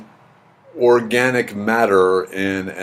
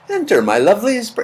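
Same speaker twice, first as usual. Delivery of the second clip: high-pitched attempt at a sweet voice; badly faked sweet creature voice; sweet, kind belly; 10 semitones higher than usual. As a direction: coo it higher, trying to sound kind. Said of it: "yours probably has a sweet, kind voice".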